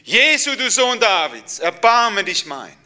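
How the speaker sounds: high, loud desperate cry, slowing at the end. Acted. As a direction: shout it over the crowd. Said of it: "Und ich habe noch lauter geschrien".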